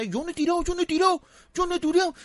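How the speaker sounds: high-pitched mocking whine; whining denial. Thirty-year-old neighbour denying the rockets, high mocking voice. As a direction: mimic a culprit denying it over and over. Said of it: "El desgraciado todo lo negaba".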